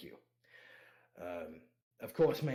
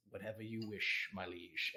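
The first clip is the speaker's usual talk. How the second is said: softer, lower bow of a reply; low subservient Patrick; 7 semitones lower, 8 dB quieter than usual. Acted. Patrick yielding to his lord in a low, soft voice. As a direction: defer quietly to his liege. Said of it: "It goes".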